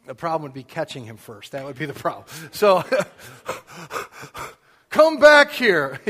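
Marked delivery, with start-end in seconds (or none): 4.9-6.0 stretched out, high and loud, playfully hollering after a fleeing son; mock yell. Dad hollering at his escaping son, mock yell. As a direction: holler it slow like chasing a runaway kid. Chasing son to discipline him.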